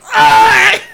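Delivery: high loud horrified scream; mock scream. Strangled kid on the ground, high mock scream. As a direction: let out a horrific shriek.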